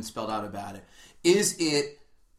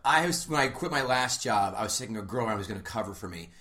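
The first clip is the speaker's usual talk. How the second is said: ordinary delivery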